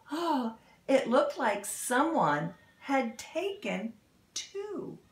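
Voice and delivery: lively storybook read-aloud; animated picture-book narration; reading to children. Acted. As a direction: read it like storytime for little kids.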